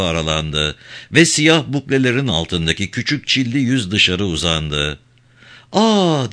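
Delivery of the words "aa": higher-pitched, understated exclamation of surprise; mild startled cry. A narrator's reading. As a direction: let out a small startled "ah". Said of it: "diye bağırdı kadın". (hafif bir şaşkınlık nidası)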